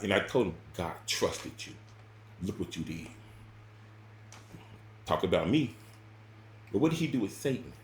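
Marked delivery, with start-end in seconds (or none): 0.8-3.1 hushed, unvoiced reproach; low whisper scolding the pastor; whispered rebuke. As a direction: whisper it like an accusation.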